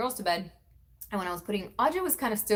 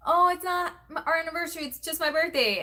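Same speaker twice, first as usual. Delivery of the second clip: puzzled correction; 9 semitones higher, 4 dB louder than usual. Retold or imitated. Correcting the kids, confused.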